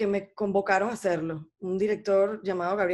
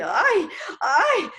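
imitated wailing moan; 8 semitones higher, 6 dB louder than usual. Guest imitating [name]'s wail in the song, loud and high. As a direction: cry out the song's anguished moan. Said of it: "la parte esta del gemido".

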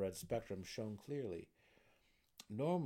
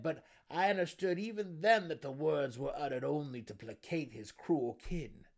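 loud, raised gnome testimony; insistent burrow warden; 6 semitones higher, 8 dB louder than usual. A narrator's reading. Warden explaining the drow's mercy, insistent. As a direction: press the point loudly as the gnome.

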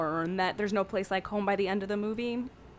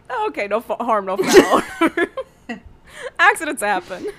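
higher and much louder, breaking into real laughter; laughing through it; 7 semitones higher, 9 dB louder than usual. Real, not acted. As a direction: giggle your way through a breezy reply. Laughing while voicing the witch.

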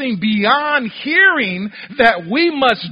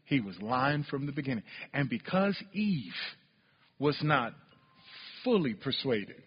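much softer and lower sermon voice; quiet preaching; 10 semitones lower, 13 dB quieter than usual. Acted. To the congregation, hushed low preaching about Eve. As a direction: bring it down to a hush.

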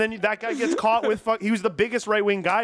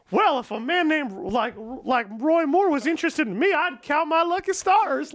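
mock proud mother; 8 semitones higher than usual. Mom quoted defending Roy Moore.

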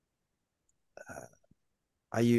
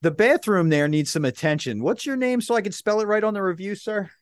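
much higher, mock-official sweet voice; hammed-up polite reviewer with a veiled threat; online accessibility reviewer; 7 semitones higher than usual. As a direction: pose as a reviewer to pressure staff. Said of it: "go into the whole thing as like".

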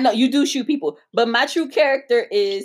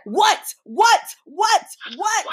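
irritated repeated what; 8 semitones higher, much slower than usual. Retold or imitated. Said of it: "he's like".

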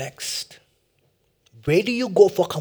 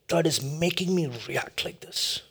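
whisper; 6 dB quieter than usual. Acted. Sermon climax confession.

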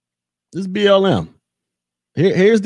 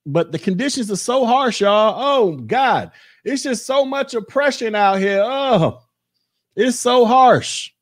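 higher, hammed-up sighing over oppression; mock lament; 6 semitones higher than usual. Vlogger sarcastically mourning harsh conditions over twerking video, mock lament. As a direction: fake a dramatic complaint, tongue in cheek.

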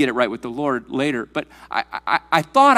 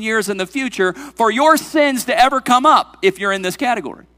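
higher, driving, forceful sermon voice; emphatic preaching; 6 semitones higher, 6 dB louder than usual. Real, not acted. Preacher ruling out any mistrial, forceful and raised. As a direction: hammer the point home to the congregation.

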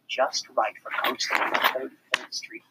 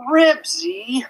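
dismayed groan of the name; exasperated groan; 7 dB louder than usual. A narrator's reading. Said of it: "groaned Henry".

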